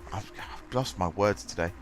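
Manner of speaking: usual speaking voice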